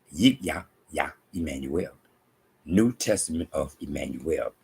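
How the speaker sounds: ordinary talk, nothing marked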